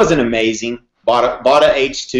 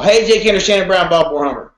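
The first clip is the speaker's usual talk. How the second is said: a raised, upbeat sales-call greeting; higher, cheery mock salesman voice; imitating the salesman; 4 semitones higher than usual. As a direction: play the chipper car salesman on the phone. Salesman's third call retold.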